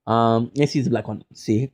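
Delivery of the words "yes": ordinary delivery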